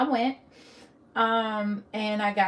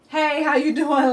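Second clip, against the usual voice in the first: mock breezy greeting, louder and higher; mocking casual greeting; 6 semitones higher, 9 dB louder than usual. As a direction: parody how offhand the doctor sounded. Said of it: "how do you just go from".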